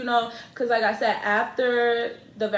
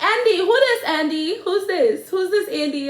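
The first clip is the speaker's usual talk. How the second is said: high, loud mock Guyanese mother; hammed-up, inaccurate Guyanese accent, pitched way up; botched Guyanese accent; 8 semitones higher, 5 dB louder than usual. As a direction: attempt a Guyanese accent that drifts African or Jamaican. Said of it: "That kind of accent sound a little African".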